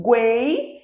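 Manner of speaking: "guei" as a single gliding syllable; "guei" held in one continuous sweep; one unbroken sound. Shown as a demonstration. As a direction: say "guei" without a break between u, e, i. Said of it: "é o único som, não tem pausa".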